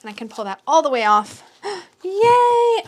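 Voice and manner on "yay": excited yay. Real, not acted. Freeing the cards from sleeve.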